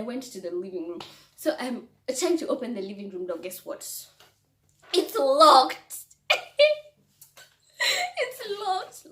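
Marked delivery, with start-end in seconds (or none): 4.9-6.0 loud, higher punchline cry; exclaimed reveal. Retold or imitated. Reveals the locked door. 6.3-9.1 high voice breaking with laughter; laughing. Real, not acted. Repeats it while cracking up.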